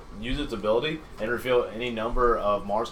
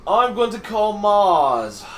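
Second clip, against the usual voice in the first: dramatic announcement; 6 semitones higher, 5 dB louder, much slower than usual. Acted. Dramatic card play announcement.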